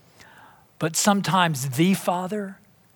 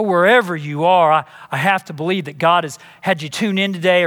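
ordinary talk, nothing marked

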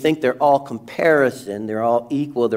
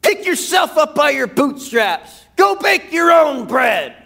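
harsh dismissal; 12 semitones higher than usual. Retold or imitated. Preacher voicing an uncaring father, scolding shout. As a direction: throw it back like a stingy neighbor. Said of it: "The father doesn't say".

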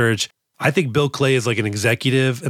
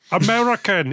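higher-pitched mimicked actor's voice; Alan Rickman; 8 semitones higher than usual. Fan mimicking the actor's American lines, high put-on voice. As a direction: put on the same unmistakable voice. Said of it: "It's the same fucking voice".

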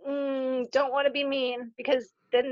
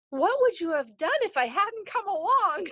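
put-on old lady voice; 8 semitones higher than usual. Elderly woman's question retold.

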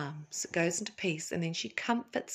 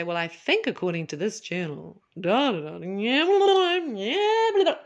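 sarcastic parody of a dry analyst; mocking blah blah; 4 dB louder than usual. Mocking someone reading from journal.

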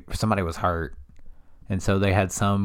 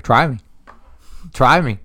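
mock stare-down taunt; 5 semitones higher, 8 dB louder than usual. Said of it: "he's like".

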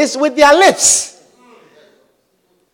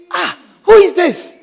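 surprised; 8 semitones higher than usual. Pretend. Visitor surprised finding singer.

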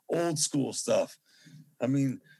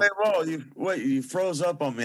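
calling out; 5 semitones higher, 4 dB louder than usual. Real, not acted. Video call froze, calling out.

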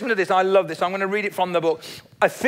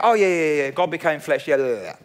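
dismissive shrug; 4 semitones lower, faster than usual. Retold or imitated. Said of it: "I don't wanna go".

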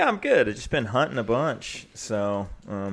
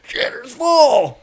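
much higher, louder, slow hammed-up shout; movie quote yell; 11 dB louder, much slower than usual. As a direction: belt out the movie line like the parents. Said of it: "the parents would come around the corner and go".

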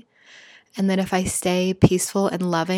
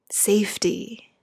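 1 whispered; slower than usual. Real, not acted. Guiding meditation.

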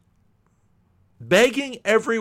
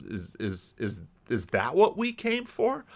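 soft, stammered start rising into a puzzled question; mock bewilderment, faltering at first; baffled questioning; 5 semitones lower, 6 dB quieter than usual. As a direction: hesitate quietly, then ask in acted disbelief. Voicing the baffled friends' reaction.

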